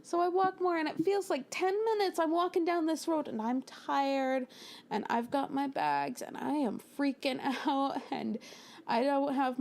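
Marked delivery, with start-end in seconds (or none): none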